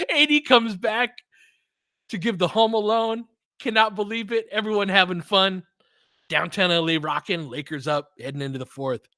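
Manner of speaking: high-pitched hyped game call; elated play-by-play. Acted. Fan calling the quarter's end, elated play-by-play. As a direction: call it like the crowd is roaring.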